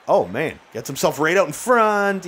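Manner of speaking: normal talking voice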